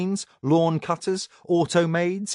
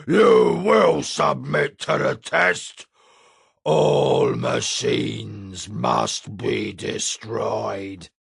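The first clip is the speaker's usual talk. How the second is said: menacing giant voice; 3 semitones lower, much slower than usual. A narrator's reading. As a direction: pronounce doom like a brute. The giant's verdict.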